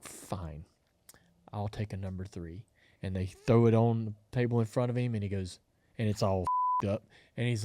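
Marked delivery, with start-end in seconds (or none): 0.0-2.7 whispered, much softer resignation; defeated mutter. Retold or imitated. Customer grumbling his order after breakfast refusal. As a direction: give in under your breath. Man gives up, quietly orders.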